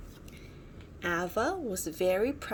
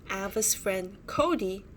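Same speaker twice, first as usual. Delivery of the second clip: ordinary delivery, nothing marked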